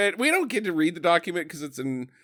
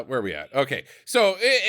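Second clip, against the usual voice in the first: usual speaking voice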